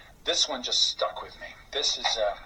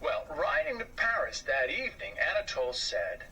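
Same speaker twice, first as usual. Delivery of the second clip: ordinary delivery, nothing marked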